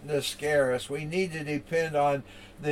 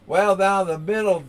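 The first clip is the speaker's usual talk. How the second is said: normal talking voice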